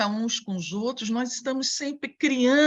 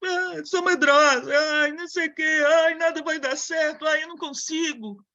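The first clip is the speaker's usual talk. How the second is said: a higher, whiny frightened voice; fearful whining; 5 semitones higher than usual.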